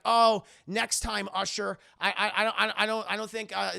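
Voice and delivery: loud, halting excuse; stammering refusal. Retold or imitated. Stan turning down infected Usher, stammering excuse. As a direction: stumble over the start as you decline.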